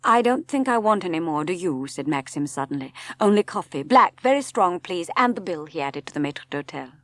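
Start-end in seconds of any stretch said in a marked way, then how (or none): 0.0-5.7 a touch of a curt order to the waiter; brisk, raised, wanting to leave; lightly voiced Maxim, abrupt. A narrator's reading. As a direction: Maxim hurrying the meal along.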